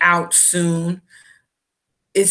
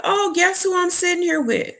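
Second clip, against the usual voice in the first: high, gleeful mock girlfriend voice; imitating happy surprise; 11 semitones higher than usual. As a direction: tease the news in a high singsong. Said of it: "She thought it was gonna be a good thing".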